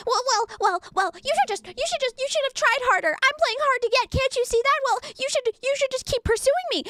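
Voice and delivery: high, whiny mock of a woman playing hard to get. Mocking a woman who wants to be chased, high and whiny. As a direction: squeak it out high and petulant. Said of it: "she's like".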